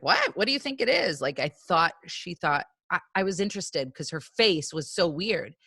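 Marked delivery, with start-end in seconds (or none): 0.0-1.2 mildly acted high, questioning voice; puzzled reply. Stepmom questioning her staring stepdaughter, retold puzzlement. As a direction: imitate a startled, probing question.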